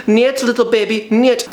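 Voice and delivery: playful Russian mom impression; mock Russian mother. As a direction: coo "Niet, little baby" in a hammed Russian accent. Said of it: "a Russian mother going".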